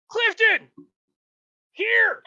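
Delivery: put-on old guard calling Clifton over to share the gold. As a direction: call him over sharply. Guard calls underling.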